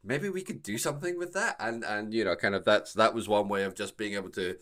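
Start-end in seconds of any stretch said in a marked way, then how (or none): 0.0-1.5 higher, louder mock pitch from the producers; lightly acted hopeful suggestion to colleagues; producers pitching an idea. Voices producers pitching pilot reuse.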